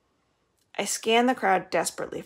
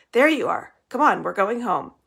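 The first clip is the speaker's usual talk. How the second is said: louder, hurried worry; urgent; 4 semitones higher, 6 dB louder than usual. A narrator's reading. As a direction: rush her home urgently. Papa worried, hurrying her.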